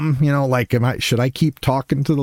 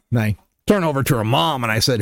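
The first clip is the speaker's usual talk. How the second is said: normal talking voice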